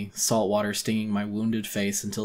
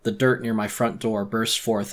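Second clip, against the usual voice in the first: ordinary delivery, nothing marked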